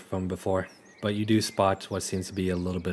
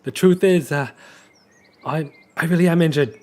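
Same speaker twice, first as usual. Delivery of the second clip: put-on high, loud accented voice; Riolus's accent; 7 semitones higher, 6 dB louder than usual. Riolus giving in under threat, in his high put-on accent. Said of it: "I'm giving you the accent".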